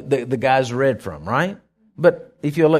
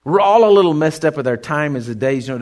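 ordinary talk

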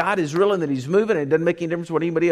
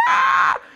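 high-pitched panicked shriek; sudden shrill cry of panic; freak-out scream; 4 dB louder than usual. Acted. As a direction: scream as if losing it completely. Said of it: "They freak out".

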